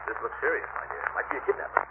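hushed. Acted. Suspects a kidnapper, hushed to niece.

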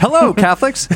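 high, bright joking announcer hello; cheery priestly greeting in jest; mock greeting. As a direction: hail the Catholics playfully.

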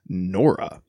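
NORA slowly set apart like a quotation; air-quote inflection. Shown as a demonstration. Co-host reading the recap doubts the name, air-quote inflection. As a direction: put audible quotation marks round NORA. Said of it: "weird inflection in my voice".

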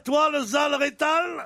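a mock Italian accent, drawled; half-hearted Italian accent. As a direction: put on a bad Italian accent for the insult. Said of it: "Avec ton accent de merde".

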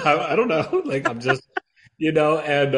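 loud laughing high talk. Real, not acted. Laughing at his own joke while telling the host. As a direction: laugh it out, words tumbling.

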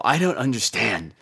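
normal talking voice

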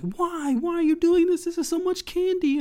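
put-on very high panicked little-girl voice; mock panic. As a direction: imitate an overwhelmed kid squealing in alarm. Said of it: "She's, like, panicking".

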